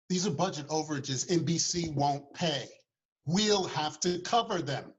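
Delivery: a hint of a nervous studio suit; worried studio executive. Narrator voices a studio executive.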